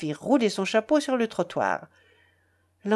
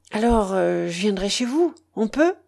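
ordinary delivery

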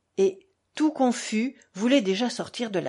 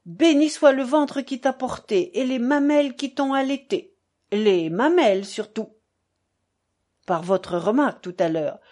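higher, emphatic theatrical outpouring; declaiming buffoon; 3 semitones higher, 4 dB louder than usual. Acted. Fiodor confesses his shame to the staré, declaiming effusively. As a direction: proclaim it like a clown making confession.

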